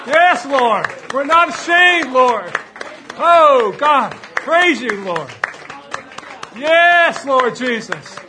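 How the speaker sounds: loud, high ecstatic praise shouts; exultant worship; fervent worship praise. Real, not acted. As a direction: cry out praise to the Lord at full force.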